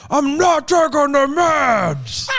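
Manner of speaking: high, slow, raging mock refusal; mock rage at the meds; mock Hulk rage. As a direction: ham up a Hulk-mode tantrum. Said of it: "Full Hulk mode".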